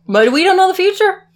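high, loud theatrical protest; mock dramatic high voice. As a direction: ham it up like a soap star.

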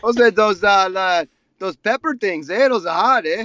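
a lightly hammed Canadian accent ending on eh; mock Canadian accent. Friend riffing on Canadians at the table, mock Canadian accent. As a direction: play the Canadian with every line ending in eh. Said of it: "How do they say it in Canada?".

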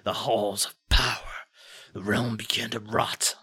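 put-on heavy-hearted old warrior remembering; weary lament. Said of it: "Sitad sighs a heavy sigh".

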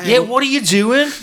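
high, loud fake British accent; put-on British accent. Captive in the trunk fooling his captors, put-on British accent. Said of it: "do that goddamn stupid accent".

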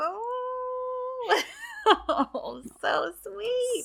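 high, laughing coo of delight; swooning squeal. Real, not acted. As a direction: melt over a sweet scene. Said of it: "So dang sweet".

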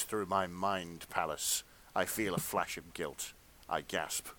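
measured, hushed-down storyteller read; dramatic fan fiction narration. A narrator's reading. Reader performing steamy fan fiction for friends, dramatic narration. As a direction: read it as earnest literary erotica.

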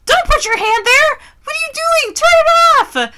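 high, loud mock screech; shrieked scolding from the next room; imitated yelling. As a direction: yell it like an alarmed mother. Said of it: "then I hear yelling".

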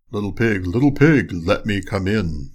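low wolf voice, drawing out the last words; wolf at the door. A narrator's reading.

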